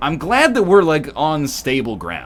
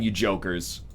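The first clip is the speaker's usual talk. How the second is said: ordinary delivery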